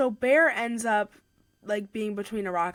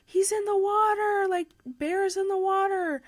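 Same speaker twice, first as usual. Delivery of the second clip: high, frantic cry that he's in the water; panicked mother screaming it out; hysterical; 10 semitones higher than usual. Retold or imitated. Mother retelling finding her son in the water, hysterical and high.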